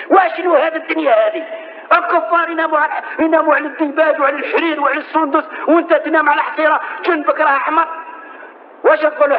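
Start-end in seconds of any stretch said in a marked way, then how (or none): 0.1-1.4 raised, rising plea of a grieving companion; higher pleading cry. Retold or imitated. Omar's plea, repeated higher. 1.9-7.9 raised voice building to a shout; higher protesting plea. Retold or imitated. Omar protesting, ends shouting.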